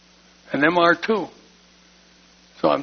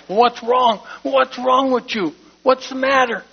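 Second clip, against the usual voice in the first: higher, louder anxious questioning; a worried wife nudging her husband in church, asking higher and louder; 9 semitones higher, 4 dB louder than usual. Retold or imitated. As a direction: imitate Gloria pressing him, higher pitched. Wife elbowing him, worried.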